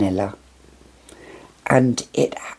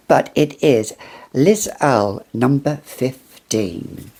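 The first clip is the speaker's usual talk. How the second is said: usual speaking voice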